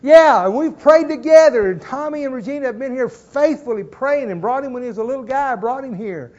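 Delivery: normal talking voice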